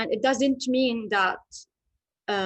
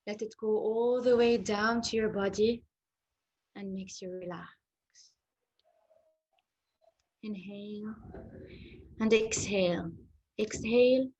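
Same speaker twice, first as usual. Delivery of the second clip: hypnotherapy voice. Acted. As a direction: lull them into calm, drifting into whispers. Leads a hypnotherapy relaxation.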